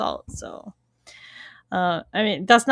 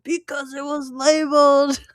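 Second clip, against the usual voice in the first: higher, strained growl of outrage; imitated furious growl; mock growl; 4 semitones higher, 3 dB louder than usual. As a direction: growl it as an overacted grievance. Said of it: "And he growls at him".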